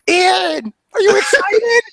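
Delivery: high, loud mock-thrilled shout; teasing excitement. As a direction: yell it to scare your nervous friend. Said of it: "I'm like".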